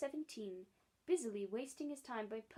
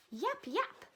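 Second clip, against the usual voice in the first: high sharp little dog yaps; quick yapping; 10 semitones higher, 7 dB louder than usual. Acted. Dog barking at Mary Poppins, quick high yaps. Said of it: "said Andrew several times very quickly".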